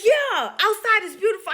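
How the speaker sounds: high, loud enthusiasm; excited patient. Retold or imitated. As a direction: imitate a patient marveling aloud.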